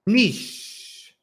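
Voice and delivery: niche softly said as "neesh"; correct niche. Shown as a demonstration. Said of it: "It really is like niche".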